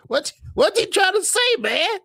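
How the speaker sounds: mock offended.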